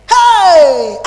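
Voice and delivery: a loud, drawn-out hey of exasperation; loud exclamation. Real, not acted. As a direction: throw up your voice at endless arguing. Exclaiming about husband's arguing.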